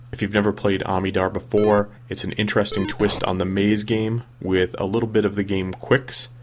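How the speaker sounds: ordinary talk, nothing marked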